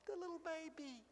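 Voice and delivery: put-on a soft, hushed little baby; almost dropped to nothing; hushed wonder. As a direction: breathe the words in awe. Repeats the phrase for effect.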